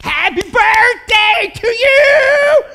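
very high, very loud sung scream; all-out ecstatic birthday yell; screaming passionately. Acted. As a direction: belt it right in his face. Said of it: "I'm, like, screaming it out".

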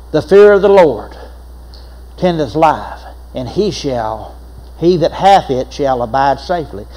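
ordinary delivery

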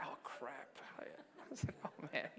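very hushed, sinking mutter; dismayed aside. Retold or imitated. Priest spotted in a pub, hushed dismay. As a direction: say it under the breath in mock dread.

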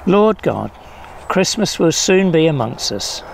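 ordinary talk, nothing marked

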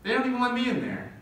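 They don't even let me in there, with don't leaned on; emphatic repeat. Shown as a demonstration. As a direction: land the punchline again, lifted. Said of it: "repeated for emphasis".

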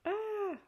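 high, loud scream; startled scream. Acted. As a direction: shriek in sudden fright. Customer screams.

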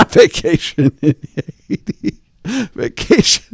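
laughing. Real, not acted. Laughing at clip.

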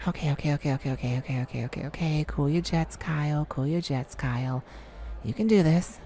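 steady your own nerves under your breath; low, soft self pep talk; calming himself down. Real, not acted. Calming himself before performing.

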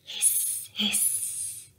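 soft unvoiced hiss; drawn-out breathy hiss; hissing heater sound. A narrator's reading. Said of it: "sighs the heater".

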